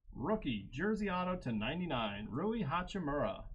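hyped prize reveal, pitch lifted; excited hit call. Real, not acted. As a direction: announce the big hit with real excitement. Announcing a big autograph hit.

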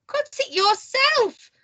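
high, slow indignant comeback; squawky, drawn-out refusal; defiant retort. A narrator's reading. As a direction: throw the order straight back. Mister Cheeky talks back.

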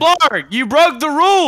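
high, loud hammed-up scolding; mock judgement. Game host to a cheating player, mock-stern scolding. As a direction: pronounce a mock verdict, loudly.

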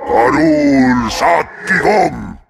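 normal talking voice

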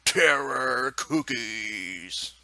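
slow, loud and drawn out; all caps rage. Retold or imitated. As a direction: bellow it like a furious sign. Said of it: "I wrote in all caps rage".

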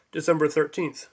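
ordinary delivery, nothing marked

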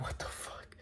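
breathless whispered shock. Retold or imitated. Reacting to kids yelling in a line, whispered. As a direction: whisper it under your breath, stunned.

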